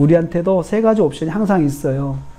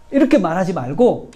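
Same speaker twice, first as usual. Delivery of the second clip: ordinary talk, nothing marked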